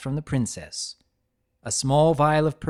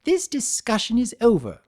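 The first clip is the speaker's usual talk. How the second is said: lifted, high and final; lofty dismissal; haughty; 10 semitones higher, 3 dB louder than usual. A narrator's reading. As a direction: shut the topic down with a sniff. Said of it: "harumph, nose in the air".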